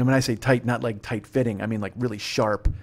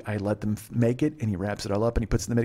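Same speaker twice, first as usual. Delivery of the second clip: ordinary talk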